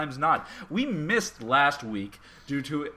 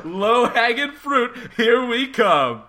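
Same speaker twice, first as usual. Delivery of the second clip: hammed-up announcement; 8 semitones higher, 7 dB louder, slower than usual. Hammy announcement of easy jokes.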